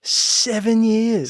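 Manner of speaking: high, drawn-out incredulous echo; disbelief. Real, not acted. Co-host questions the seven-year answer, disbelieving.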